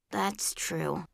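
low, soft concession; reluctant admission. A narrator's reading. Joey conceding to the girl who refused him, soft admission. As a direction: admit it softly, pitch dropped. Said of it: "Joey admitted".

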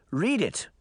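lightly acted begging. A narrator's reading. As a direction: plead to hear it. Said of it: "Daphne begged".

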